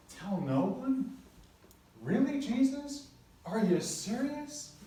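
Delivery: high, slow disbelief; can you believe this, Jesus; incredulous. Retold or imitated. Disciples questioning Jesus, incredulous and high.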